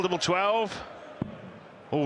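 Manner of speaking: normal talking voice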